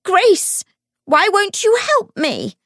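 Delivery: very high, loud desperate shriek. A narrator's reading. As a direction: shriek it as a last plea for help. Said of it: "she shrieked".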